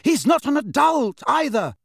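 high, loud, heated retort; flushed anger. A narrator's reading. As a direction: snap back with voice pitched right up. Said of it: "the colour rising in her cheeks".

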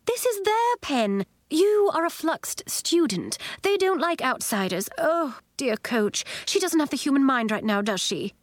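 high-pitched fussy teacher, objecting; fussy Miz Fitznickel, protesting. A narrator's reading. Miz Fitznickel warns Flamingo Nori off the pen, fussily protesting. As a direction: lift the voice and scold the intruder.

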